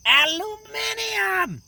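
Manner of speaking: slow, high, stubborn "Aluminium"; British pronunciation. Shown as a demonstration. Escalating pronunciation fight with Gregor, British way. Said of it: "it is pronounced".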